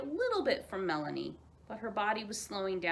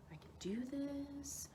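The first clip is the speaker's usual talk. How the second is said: whispered pep talk; 6 semitones higher, 11 dB quieter, slower than usual. Acted. Ostrich steeling himself.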